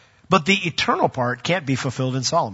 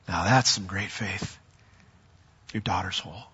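tender praise; 6 semitones lower, 8 dB quieter than usual. Retold or imitated. Jesus quietly commending the woman's faith, tender. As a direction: say it gently, like a blessing.

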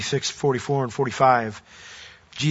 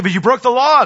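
louder, high-pitched accusation; pitched-up protest, pouncing on the culprit; acted objection; 8 semitones higher, 6 dB louder than usual. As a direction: object sharply as the mock accuser. Acted objection to Daniel in story.

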